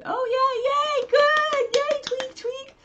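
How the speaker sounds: very high, cooing squeaky voice for the pet; pet talk. Real, not acted. Talking to her pet Tweak, very high cooing voice.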